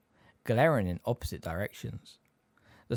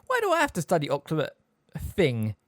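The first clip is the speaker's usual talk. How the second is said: fast, higher protesting question; blurted out; 6 semitones higher, 3 dB louder than usual. A narrator's reading. Harry questions Snape about lessons, blurting. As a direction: rush it out and stumble on the word. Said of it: "he blurted out".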